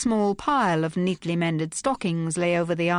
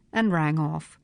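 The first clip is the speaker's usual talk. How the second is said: ordinary delivery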